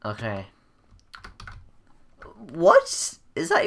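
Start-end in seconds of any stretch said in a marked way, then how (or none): 2.5-3.7 a sudden high squeal on What, rising; shocked what. Real, not acted. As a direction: yelp What way up high in disbelief. Shocked by sudden game event.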